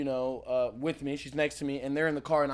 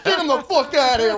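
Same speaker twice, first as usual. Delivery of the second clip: retold shout; 7 semitones higher, 6 dB louder than usual. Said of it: "He's like".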